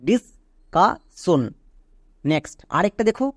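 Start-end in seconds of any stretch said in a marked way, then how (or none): 0.1-1.4 ডিসকাশন stretched out syllable by syllable; slow model word. Shown as a demonstration. Teacher to class, slowly modelling the full word. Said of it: "পুরোটা কি দাঁড়ালো".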